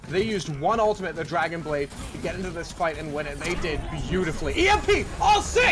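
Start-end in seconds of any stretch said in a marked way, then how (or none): 4.6-5.7 yelling the big play; excited shout. Real, not acted. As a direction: hype it up, full shout. Caster hype call.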